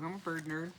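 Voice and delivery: normal talking voice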